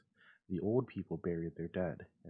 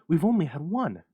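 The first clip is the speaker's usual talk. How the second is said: excited; 9 semitones higher, 12 dB louder than usual. Acted. Excited wife.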